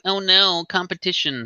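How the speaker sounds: high-pitched pretend dread; mock alarm. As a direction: imitate a company in feigned panic. Mocks company fearing competition.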